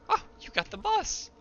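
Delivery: high excited exclamation; surprised. Real, not acted. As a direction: blurt it out amazed. Surprised by teammate's kill.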